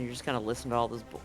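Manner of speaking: ordinary talk, nothing marked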